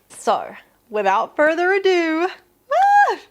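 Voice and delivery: high, loud burst of excitement before opening; excited. Real, not acted. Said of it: "I'm so excited".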